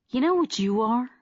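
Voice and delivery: irritated. Acted. Hodor turning on Darby with irritation, irritated. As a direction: let the irritation spill over. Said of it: "the irritation growing in his face".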